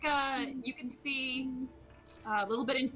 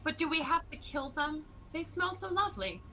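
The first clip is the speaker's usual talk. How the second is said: higher, pleading character voice; 6 semitones higher than usual. Acted. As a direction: plead sweetly for the trees.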